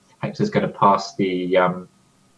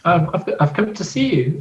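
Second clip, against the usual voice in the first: higher, acted daughter talking to daddy; 7 semitones higher than usual. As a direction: play the daughter in a raised pitch. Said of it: "you gotta do a voice".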